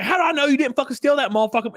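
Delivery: high, fast mock accusation; hammed-up furious questioning; retold furious. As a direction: imitate an irate clerk, rushed and high-pitched. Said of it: "she was furious with me".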